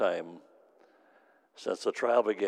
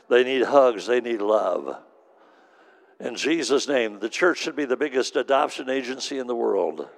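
louder, driving preacher's cadence; fervent sermon delivery, louder; full-force preaching; 3 dB louder than usual. Acted. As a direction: preach it out to the room. Preaching to the congregation.

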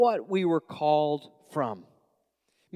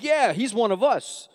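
imitated New York churchgoers, louder; New York accent; 5 semitones higher, 4 dB louder than usual. New York church welcoming one of their own, New York accent. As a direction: play approving New Yorkers in their accent. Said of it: "And then they get me".

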